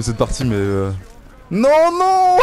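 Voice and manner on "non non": panicked no. Real, not acted. Panicked protest in game.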